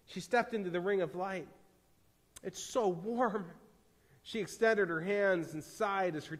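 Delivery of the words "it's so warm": slow, higher-pitched wonder; gentle, lingering delight; savoring warmth. A narrator's reading. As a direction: draw it out with relief. Woman feels the fire's warmth.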